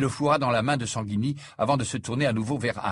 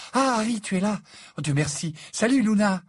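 high, relieved greeting; flustered relief; 10 semitones higher, 4 dB louder than usual. Acted. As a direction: greet them as if just rescued. Flustered Hermione greets her rescuers.

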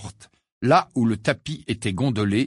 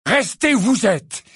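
much higher, louder, spitting restez; angry shout; 12 semitones higher, 6 dB louder than usual. Acted. Harry throwing Dumbledore's order back, shouting. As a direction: hurl the order back furiously.

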